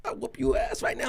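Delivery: high, held-back mock yell; mock cursing threat. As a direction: imitate an irate man threatening a fight. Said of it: "He's cursing at him".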